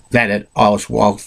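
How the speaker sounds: usual speaking voice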